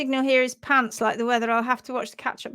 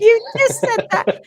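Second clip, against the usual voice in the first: high, loud scandalised reproach; laughing disbelief, pitched up loud; mock scandalised; 11 semitones higher, 8 dB louder than usual. Real, not acted. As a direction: tell off a friend in playful shock. Mock scolding co-host for rudeness.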